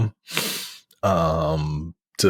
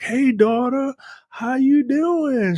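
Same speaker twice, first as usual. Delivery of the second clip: much higher, slow put-on dad voice; a sick father drawn out in a high voice; mock dying father; slower than usual. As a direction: ham up a frail dad greeting his daughter from the deathbed. Voicing dying father in retold scene.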